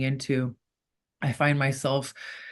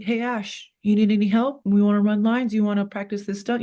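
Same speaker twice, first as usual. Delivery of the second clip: higher, friendly imitation of helpful co-stars; lightly voiced eager castmates; 6 semitones higher than usual. Castmates offering the newcomer help, lightly imitated eagerness. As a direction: imitate colleagues cheerily offering a hand.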